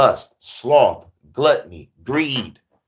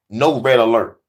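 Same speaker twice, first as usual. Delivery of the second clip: usual speaking voice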